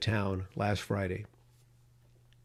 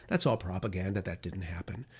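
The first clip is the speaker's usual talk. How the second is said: mock dismissive; 5 semitones lower, 7 dB quieter than usual. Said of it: "they would probably say".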